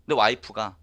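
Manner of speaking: ordinary delivery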